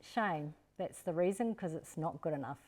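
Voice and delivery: quiet, calm, even reply; calm parent turning down a weak excuse. Shown as a demonstration. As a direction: softly settle it without arguing. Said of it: "you just calmly say".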